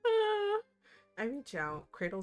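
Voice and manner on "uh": loud high flustered uh; surprised. Real, not acted. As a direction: caught off guard, blurting out. Flustered reaction.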